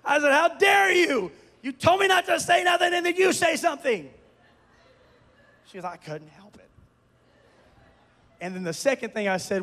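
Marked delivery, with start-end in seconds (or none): 0.3-4.1 high-pitched mock outrage; mad. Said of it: "I was mad". 5.8-6.7 small, low, soft reply; sheepish. Retold or imitated. Wife's sheepish reply, retold.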